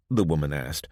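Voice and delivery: ordinary delivery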